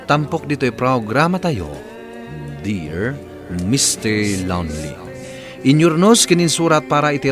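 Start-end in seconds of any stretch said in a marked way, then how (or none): none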